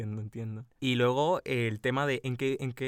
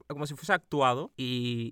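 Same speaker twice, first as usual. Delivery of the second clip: ordinary talk, nothing marked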